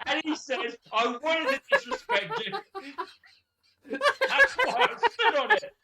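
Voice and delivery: very high, squeaky corpsing; helpless laughter. Real, not acted. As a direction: struggle to get the story out laughing. Storyteller helplessly laughing through dialogue.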